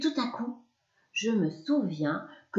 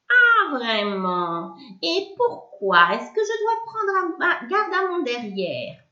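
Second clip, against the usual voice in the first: piercing high, mean little voice; a witch sneering, slow at first; sharp nasty little voice; 7 semitones higher, 6 dB louder than usual. Acted. Witch scolding the boy from the cupboard, sharp and mean. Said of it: "une petite voix pointue, aigre, méchante".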